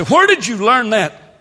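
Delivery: loud, high quoted question; mock challenge. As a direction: call it out like a challenger in the pews. Voices a listener's challenge to him.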